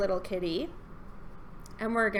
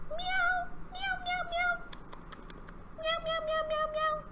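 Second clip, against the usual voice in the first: very high little mews; cat-like meowing; 6 dB quieter than usual. Pretend. Showing children a cat-like hello, high-pitched mewing. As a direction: meow hello like a kitten. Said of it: "cat-like as we can".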